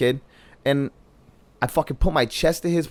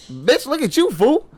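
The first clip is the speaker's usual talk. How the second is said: higher, retold snap at the guy; imitating a drunk comeback, higher; mock angry retort; 10 semitones higher, slower than usual. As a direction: fire back at him, pitched up. Retold confrontation in a club.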